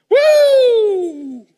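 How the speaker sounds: loud, high whoop sliding down; excited whoop. Real, not acted. Preacher whooping to the congregation before the word, excited whoop. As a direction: let out a long falling "woo".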